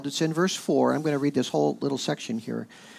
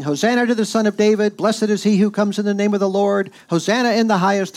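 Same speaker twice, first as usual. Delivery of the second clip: raised, louder and higher acclamation; excited crowd shouting; 6 semitones higher, 5 dB louder than usual. Acted. Crowd welcomes Jesus as the Messiah, excited shouting. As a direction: call it out like a cheering crowd, held at one high pitch. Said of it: "they were shouting".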